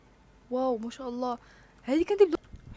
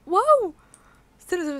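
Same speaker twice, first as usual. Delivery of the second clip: high, loud gasp then wow; 7 semitones higher, 8 dB louder than usual. Real, not acted. Gamer spotting a pet in a farm, amazed gasp and wow.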